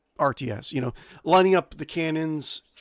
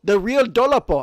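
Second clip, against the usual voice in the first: second go at the name in a higher, louder put-on accent; louder accented real Dolapo with a falling end; unknown accent on a name; 8 semitones higher, 6 dB louder than usual. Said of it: "that's how I'm gonna pronounce it with an accent".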